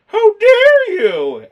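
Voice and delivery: very high, slow, loud hammed-up indignation; mock outrage. Playing the offended girl to co-host, hammed-up indignation. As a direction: gasp it like an offended diva.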